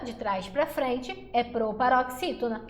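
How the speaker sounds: usual speaking voice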